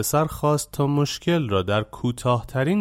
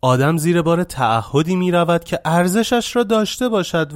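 raised, heated yell at the master; angry shout; 6 semitones higher, 4 dB louder than usual. Acted. Angry young man yelling back at Shivana. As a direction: bark it out in fury. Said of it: "خشمگین شده بود فریاد زد".

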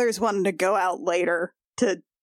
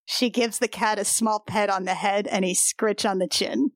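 ordinary talk

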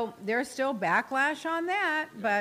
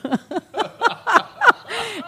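loud high laughter; 12 semitones higher, 8 dB louder than usual. Real, not acted. Laughs at own joke.

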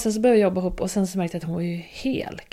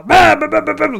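much louder, higher yelled blah-blah; roaring telling-off; 8 semitones higher, 10 dB louder than usual. Retold or imitated. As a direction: roar it from the belly. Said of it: "tog i från magen".

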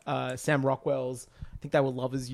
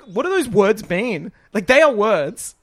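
high, slow, louder mock confusion; bewildered; 7 semitones higher, 9 dB louder than usual. Audience baffled by the final on-screen text, slow high bewilderment. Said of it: "we're like".

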